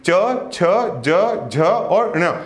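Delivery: letters drawn out very slowly; slow articulation demo. Shown as a demonstration. Teacher slowly demonstrating palatal letters to students. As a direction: stretch each letter out to show where it is formed. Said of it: "अब इसको पढ़ के देखिए".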